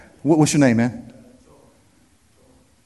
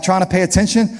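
ordinary talk, nothing marked

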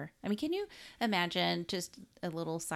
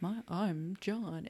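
mock man's voice; slower than usual. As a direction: play the man she's trying to be. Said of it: "her voice deepened and she started talking like a man".

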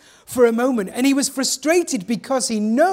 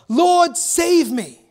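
cried out; 6 semitones higher, much slower than usual. Retold or imitated. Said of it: "immediately he cried out".

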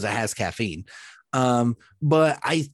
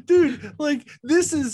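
higher, mocking disbelief at the tacky room; mock disgusted; 11 semitones higher, slower than usual. Friend at the wedding scoffing at the bottle, mock disgusted. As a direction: sneer at the cheap liquor like a snob. Retold scornful remark at wedding.

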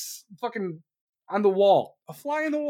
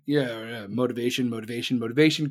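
much lower, droning mumble; mock Biden mumble; 11 semitones lower than usual. Comedian mimicking Biden mumbling Zelensky quotes, low drone. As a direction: mumble it like a half-asleep old man.

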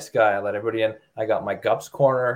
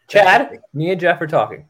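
loud, higher call to cut in; raised voice scolding a co-host; sharp callout; 7 semitones higher, 7 dB louder than usual. Real, not acted. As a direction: snap his name to shut him up. Cutting Chad off mid-chat.

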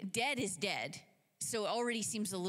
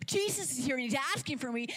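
quick, higher, amazed echo; Mary; 4 semitones higher, 4 dB louder, faster than usual. Acted. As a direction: repeat the news in excited disbelief.

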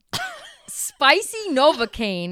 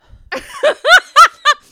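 bursting into loud laughter; big laugh after the hot sauce; 16 dB louder than usual. Real, not acted. Burning hot sauce, laughing hard.

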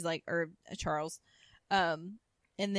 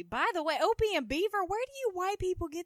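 loud, higher disbelief; mocking disbelief about sitcom names, loud and higher; incredulous; 11 semitones higher, 5 dB louder than usual. Real, not acted. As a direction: ask in amused disbelief. Incredulous aside.

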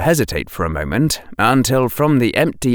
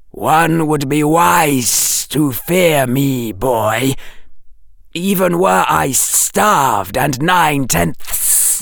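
slow, high serpent menace rising to a shout; a snake's stern warning, building; slow hissing serpent warning; 4 semitones higher, slower than usual. A narrator's reading.

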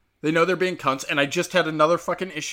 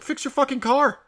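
much higher, exasperated order; retold telling-off; 10 semitones higher than usual. Driver confronting a lady over her parking, retold high and exasperated. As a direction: act out chewing someone out. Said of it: "I'm lighting up this lady".

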